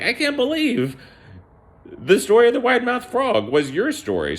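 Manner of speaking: high-pitched, incredulous protest; playfully outraged, way up high; mock disbelief. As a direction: squeal it in hammed-up disbelief. Acted retort to Auntie Jane.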